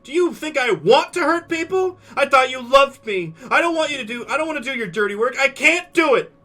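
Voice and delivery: high, loud tearful tirade; yelling. Acted. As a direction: scream it across the whole room. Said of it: "She starts yelling".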